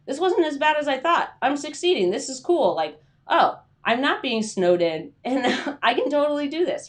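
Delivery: mock relief. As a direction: act out surprised relief.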